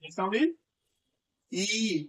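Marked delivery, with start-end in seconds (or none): 1.5-2.1 ई held long and drawn out; long vowel. Shown as a demonstration. Teacher showing a class the long vowel, drawn-out ई. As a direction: stretch ई out as one sustained vowel. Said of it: "लॉन्गली पढ़ना है, खींच करके".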